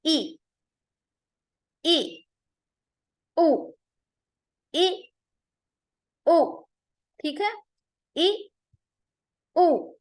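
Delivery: ای and او ended by a hard glottal catch; hard stopped ای and او; jolted hamza. Shown as a demonstration. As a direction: snap each vowel shut. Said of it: "اس کی آواز میں چھٹکا دیں گے".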